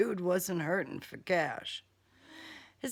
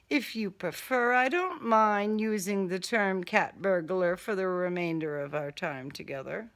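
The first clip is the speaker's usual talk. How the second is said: ordinary delivery, nothing marked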